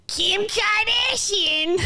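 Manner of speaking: high, slow, hammed-up "Kim Kardashian" with a laugh; mock Boston accent. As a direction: drag out "Kim Kardashian" like an over-the-top Boston influencer. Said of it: "She's like".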